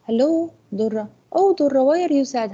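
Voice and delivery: concerned friend. Acted. Natalie on the phone asking Durra why she is sad. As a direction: sound truly worried for her. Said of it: "Natalie says".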